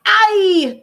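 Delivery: sharp high "Ay" of realisation; a quick startled exclamation; queen's surprised ay. Acted. Queen realizing the girl is a real princess, surprised exclamation.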